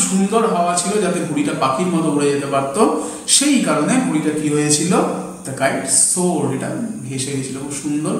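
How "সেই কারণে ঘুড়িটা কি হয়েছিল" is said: ordinary talk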